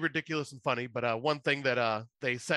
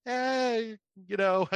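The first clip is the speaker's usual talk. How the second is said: sheepish shrug; 9 semitones higher, much slower than usual. Retold or imitated. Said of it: "I'm going".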